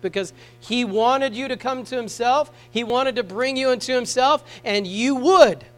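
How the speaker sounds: ordinary talk, nothing marked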